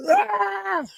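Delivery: long, very high mock scream; imitated scream. Replaying the favorite death scene, high mock scream. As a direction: scream like a straining lifter.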